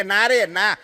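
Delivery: high, loud, laughing protest; excited blurt. Retold or imitated. Agla bursting out laughing to the air hostess.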